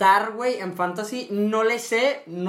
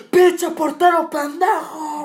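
a slow, much higher and louder stadium bellow; imitating dad's yell; 10 semitones higher, 8 dB louder, much slower than usual. Dad yelling at the goalkeeper in the stadium, a loud slow bellow. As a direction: bellow it from the stands at the keeper. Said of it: "se ponía a gritar".

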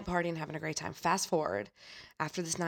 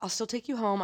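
kind driver voice; 5 semitones higher than usual. Retold or imitated. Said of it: "He said".

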